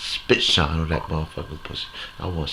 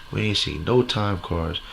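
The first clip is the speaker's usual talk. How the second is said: ordinary talk, nothing marked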